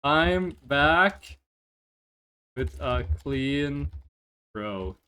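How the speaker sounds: "I'm back with a clean throat" in slow, separated pieces, dropping away; quote for the record. Shown as a demonstration. Streamer adding a quote to the list, slow and deliberate. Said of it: "Quote ad".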